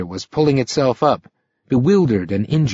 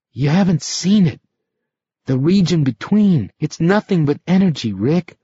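high, urgent frightened voice; a trapped voice begging to be understood; 6 semitones higher than usual. A narrator's reading. Frightened shape confesses fear.

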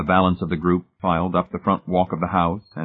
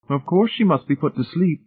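a hint of a higher-voiced lady; lightly voiced Mrs Pritchett figure; 11 semitones higher, 4 dB louder than usual. A narrator's reading. Mrs Pritchett answers Hamilton on killing Miss Rice, lightly raised voice. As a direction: lift the pitch for her verdict.

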